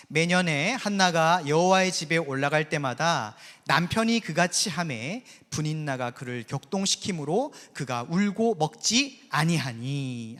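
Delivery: steady, formal Bible reading voice; slowing right down at the close; scripture reading. Acted. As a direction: read the verse aloud to the congregation. Reads scripture aloud.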